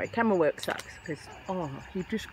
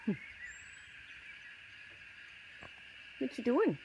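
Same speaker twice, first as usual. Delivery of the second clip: high, sing-song voice coaxing a bird; pet talk; 8 semitones higher than usual. Acted. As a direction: coax the little bird over, sweet and high. Chatting to birds while feeding.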